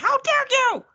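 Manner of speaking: high, loud mocking of an indignant husband; mock outrage. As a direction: throw it out like a pompous huff. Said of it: "he just storms out and is like".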